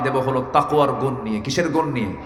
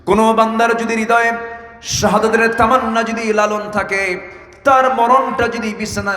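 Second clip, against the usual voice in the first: high sermon chant; full-force preaching; 9 semitones higher, 4 dB louder than usual. Real, not acted. Preacher promising martyrdom's rank to the longing believer, impassioned preaching. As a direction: proclaim it high to the congregation.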